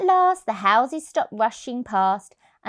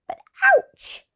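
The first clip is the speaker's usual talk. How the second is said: ouch; 6 dB louder than usual. A narrator's reading. Cat hurts paw in story.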